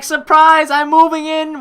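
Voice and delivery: spring it on him like a gleeful surprise; mock surprise announcement. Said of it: "He's like".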